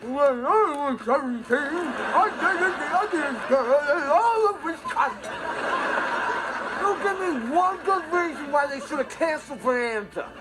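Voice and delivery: imitated old man talking through meatloaf; garbled storytelling round a mouthful of food; talking with mouth full. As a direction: mimic an old man yapping as he eats. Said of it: "he was eating meatloaf while he talked".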